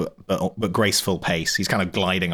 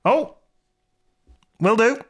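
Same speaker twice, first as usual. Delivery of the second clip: very high, loud cheerful reply; chirpy guard reply; 5 dB louder than usual. Acted. Torpek answers the warning about the arm, very high and loud. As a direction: answer brightly in a squeaky pitch.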